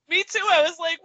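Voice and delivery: usual speaking voice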